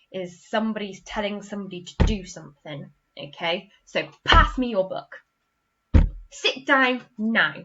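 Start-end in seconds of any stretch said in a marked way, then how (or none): 4.2-5.1 loud, bossy order; bossy command. Shown as a demonstration. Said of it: "they can be quite bossy". 6.3-7.5 slow, loud stern order; bossy command. Shown as a demonstration. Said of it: "they can be quite bossy".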